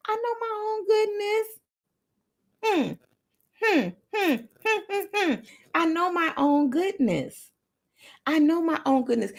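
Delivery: very high, playful sing-song; high, savouring self-praise; self-admiring affirmation. Shown as a demonstration. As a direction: coo it to yourself with high hums between. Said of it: "You gotta look back and say".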